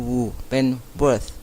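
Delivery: "worth" said very slowly with the tongue out for th; slow model word. Shown as a demonstration. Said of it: "อย่าลืมแลบลิ้นออกมานะคะ". (ออกเสียง worth ช้าๆ แลบลิ้น)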